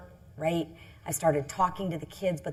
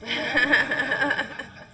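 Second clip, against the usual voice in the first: short real laugh, higher; 6 semitones higher, 3 dB louder than usual. Real, not acted. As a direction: laugh at your own confession. Laughs at not telling husband.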